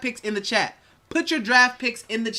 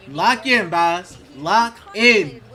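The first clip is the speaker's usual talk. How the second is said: hype; 5 dB louder than usual. Real, not acted. Hyping up the team before the draft pick.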